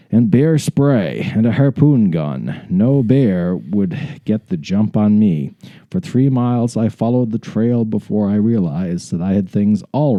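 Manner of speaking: measured, deliberate read-aloud; dramatic story narration. Acted. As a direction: narrate it like a grand adventurer's memoir. Resumes reading story.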